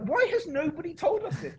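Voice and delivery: very high Welsh exclamation; Welsh accent. Retold or imitated. Race director astonished at news of hippos, high Welsh accent. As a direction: imitate her in high-pitched Welsh. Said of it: "That was a great Welsh accent".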